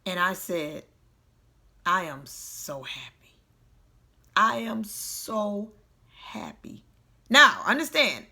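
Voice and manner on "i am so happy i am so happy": slow, hushed, near-whispered claim of happiness; hurting. Retold or imitated. Retelling how they forced themselves to say it while down, hurting. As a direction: say it weakly through pain, barely voiced. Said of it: "I was hurting when I was saying this".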